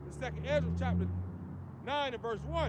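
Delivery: loud preacher reading scripture. Acted. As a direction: proclaim the scripture to the street crowd. Reads scripture aloud in sermon.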